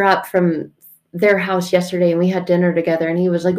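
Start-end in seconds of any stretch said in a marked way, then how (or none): none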